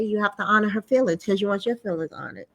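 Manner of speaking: ordinary delivery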